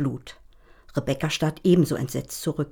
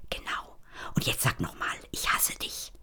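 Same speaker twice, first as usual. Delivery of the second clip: unvoiced whisper, much softer; 7 semitones lower, 10 dB quieter than usual. A narrator's reading. Gitti whispers to Rebecca, egging her on. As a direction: breathe it out without voice. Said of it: "Gitti flüstert".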